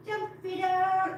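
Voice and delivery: high, drawn-out pet talk; coaxing cats. Real, not acted. Owner urging a kitty to jump, slow high coaxing. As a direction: draw it out to coax a pet.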